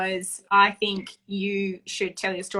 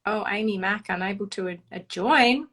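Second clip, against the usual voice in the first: ordinary talk, nothing marked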